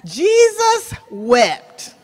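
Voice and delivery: slow, loud stress on Jesus; slow and weighty. Real, not acted. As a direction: let each word land heavily. Quoting the shortest verse.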